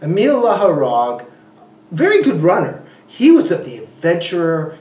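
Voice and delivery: ordinary talk, nothing marked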